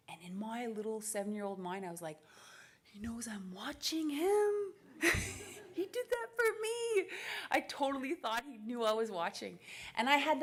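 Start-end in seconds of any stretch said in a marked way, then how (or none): 2.8-7.1 put-on high, drawn-out awestruck kid; thrilled wonder. Seven-year-old watching her hero win, thrilled wonder. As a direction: gush it like a starstruck child. Thrilled child's reaction to TV race.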